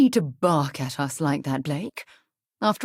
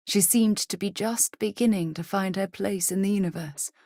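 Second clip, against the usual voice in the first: ordinary talk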